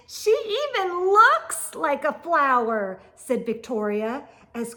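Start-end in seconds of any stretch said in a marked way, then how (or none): 0.1-3.0 taunting. A narrator's reading. Victoria taunting.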